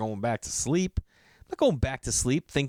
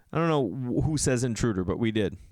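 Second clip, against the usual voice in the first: ordinary talk, nothing marked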